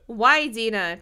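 loud, urgent question; forceful, falling appeal; demanding. A narrator's reading. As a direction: demand it with a punched "Why". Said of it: "Tracy demanded".

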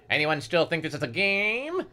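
a touch of booming menace; mild boom. A narrator's reading. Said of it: "the gunman boomed".

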